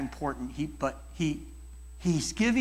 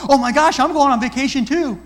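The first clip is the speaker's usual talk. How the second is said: a hammed-up me-too squeal, higher and much louder; mock one-upping; 10 semitones higher, 12 dB louder than usual. Listener hijacking a friend's news, mock one-upping. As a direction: steal the moment and make it about you. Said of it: "You don't go".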